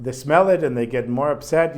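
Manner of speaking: ordinary talk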